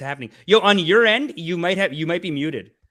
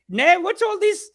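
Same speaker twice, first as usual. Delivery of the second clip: much higher, haughty royal mother voice; mock Queen; 14 semitones higher, 5 dB louder than usual. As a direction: play the Queen demanding a parade. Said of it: "it's like ... I'm your mother".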